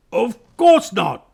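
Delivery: slow, high, loud bear protest; very shocked. A narrator's reading. Bear denying he would suck his paws, very shocked. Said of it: "in a very shocked voice".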